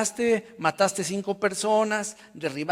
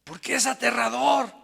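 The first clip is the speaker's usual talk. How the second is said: higher-pitched mock yell, mildly raised; retold shout; 8 semitones higher, 4 dB louder than usual. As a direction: call it out like a puzzled listener. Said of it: "le gritaron". (grito imitado, a media voz)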